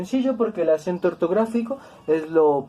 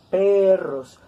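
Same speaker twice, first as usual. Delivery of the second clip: "Perros" stressed and drawn out on the first syllable, PE-rros; 3 semitones higher, 4 dB louder than usual. Shown as a demonstration. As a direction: lean hard on the "Pe" of "Perros". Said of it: "Sabemos que el acento está en esta sílaba".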